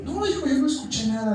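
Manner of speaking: high-pitched mock-innocent denial; feigned innocence. Pastora reassuring young helper she heard no insults, feigned innocence. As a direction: imitate her playing dumb, voice lifted high. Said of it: "Todavía me dice así".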